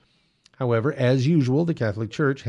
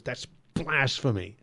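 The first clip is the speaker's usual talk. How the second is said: imitating angry caller; 4 dB quieter, slower than usual. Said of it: "his voice was shaking. He was so mad".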